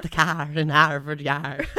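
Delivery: put-on slow, shaky, laughing Boston accent; creepy quavering Boston accent. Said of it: "Your shaky voice kinda scared me there".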